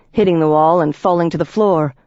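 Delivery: usual speaking voice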